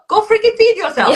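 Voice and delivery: high, loud mock-firm order; insistent command. Coach insisting to listener the client must eat, high loud command. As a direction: push her hard to go eat.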